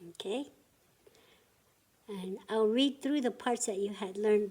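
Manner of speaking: usual speaking voice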